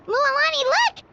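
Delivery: high, excited call; urgent alert. Acted. Companion alerts Mualani to a sight, urgent call. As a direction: call a friend's attention quickly.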